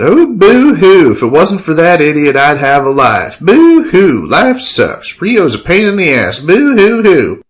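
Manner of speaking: high, loud, drawn-out boo-hooing; mock sobbing. Killian mocking Enrique's complaints, exaggerated fake sobbing. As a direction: wail it in exaggerated fake self-pity. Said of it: "made exaggerated sobbing sounds".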